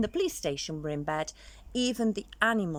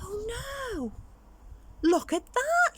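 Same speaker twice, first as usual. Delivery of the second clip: much higher startled skeleton cry; alarmed skeleton voice; big skeleton dismay; slower than usual. Acted. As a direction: gasp at the broken dog. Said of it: "said the big skeleton".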